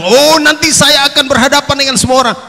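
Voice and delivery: swaggering braggart's promise; brash boast. Acted. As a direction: brag like you fear nobody. Peter's brash boast, retold.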